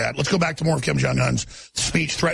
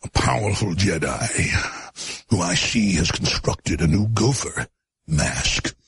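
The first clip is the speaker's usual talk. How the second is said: dramatic slow narration; 7 semitones lower, slower than usual. Acted. Alex Jones dramatic narration.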